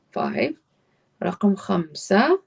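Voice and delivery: ordinary delivery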